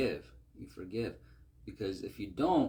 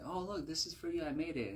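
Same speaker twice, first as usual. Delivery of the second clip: higher, lightly voiced proud little kid; eager child; 7 semitones higher, 3 dB quieter than usual. Boy handing his mom a paper turkey, eager. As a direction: offer it up like a hopeful child.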